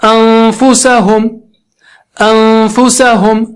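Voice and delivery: انفسہم very slow, the n hummed long through the nose; ready the lip for ف while holding the nasal n in انفسہم; slow, level recitation with a long hum in انفسہم; recited ghunna. Shown as a demonstration. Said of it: "ناک سے آواز نکالنا ہے".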